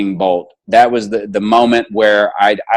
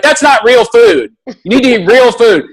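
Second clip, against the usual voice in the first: scolding nurse; 10 semitones higher, 4 dB louder than usual. Retold or imitated. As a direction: lay down the law like a stern nurse. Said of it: "she started quizzing me".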